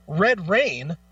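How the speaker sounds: ordinary talk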